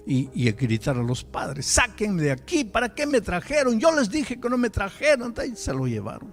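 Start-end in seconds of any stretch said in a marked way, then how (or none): none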